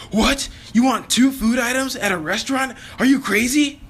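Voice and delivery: high, loud mock-outraged shriek; exaggerated freak-out. Waitress to diner ordering two meals, exaggerated freak-out. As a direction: blow it up into a hammed-up, incredulous yell. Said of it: "she starts freaking out".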